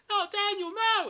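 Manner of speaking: mock mother's scream, very high and loud; hammed-up falsetto panic; Nancy. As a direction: shriek desperately for your son. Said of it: "she's screaming".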